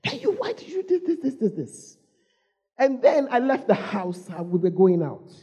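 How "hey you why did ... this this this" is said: mock reprimand. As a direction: scold a naughty puppy in a retold voice. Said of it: "I reprimanded him".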